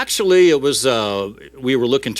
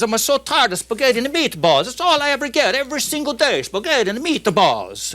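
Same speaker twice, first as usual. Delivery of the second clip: high, whining Italian complaint; comic Italian workman at the end of his rope; Italian worker; 12 semitones higher than usual. Acted. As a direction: moan theatrically as a fed-up Italian. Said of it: "the Italian opens his container first".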